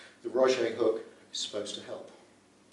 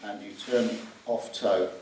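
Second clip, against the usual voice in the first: normal talking voice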